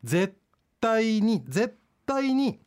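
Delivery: 絶対に drawn out high, very slowly; stress on 絶対に. Acted. Warning the child firmly.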